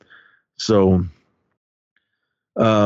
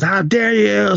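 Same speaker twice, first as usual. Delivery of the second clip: much higher, sharp mock outrage; outraged mother; 12 semitones higher, slower than usual. Mother outraged at her son's drawing, mock angry. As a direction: fling it back like a furious mom. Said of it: "And she goes".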